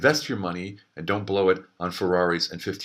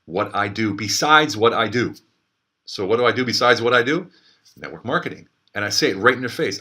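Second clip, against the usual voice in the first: usual speaking voice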